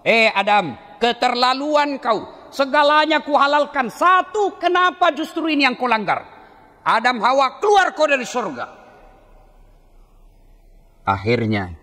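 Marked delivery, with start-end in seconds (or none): 0.0-8.7 divine rebuke. Acted. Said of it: "Kedengaran suara dari aras Allah".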